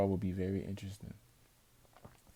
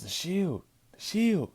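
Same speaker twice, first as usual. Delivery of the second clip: much higher and louder shout; imitating Heyman screaming about the Shield; alarmed shout; 11 semitones higher, 6 dB louder, slower than usual. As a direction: warn him in a panic. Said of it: "Paul Heyman says".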